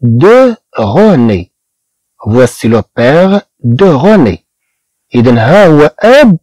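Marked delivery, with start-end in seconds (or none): 0.0-4.5 each word of "voici le père de René" stretched out slowly; slow French model sentence. Shown as a demonstration. Teacher reading the French example slowly to students. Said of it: "أب من؟".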